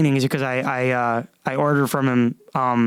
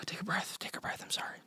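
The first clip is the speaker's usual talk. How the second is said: very soft whisper to himself; whispered self-calming; 20 dB quieter than usual. Real, not acted. Podcaster calming himself after rambling, whispered. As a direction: whisper it under your breath after a sigh.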